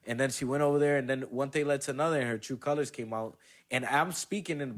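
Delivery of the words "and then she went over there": ordinary delivery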